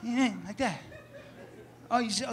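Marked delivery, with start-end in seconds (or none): none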